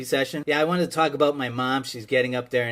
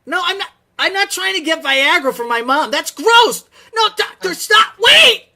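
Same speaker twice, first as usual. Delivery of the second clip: much higher and louder, rising into a frantic cry; panicked protest; 9 dB louder than usual. Real, not acted. To the doctor hanging up, frantic rising protest. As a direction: plead as the call drops, climbing in panic.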